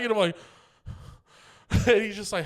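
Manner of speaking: ordinary talk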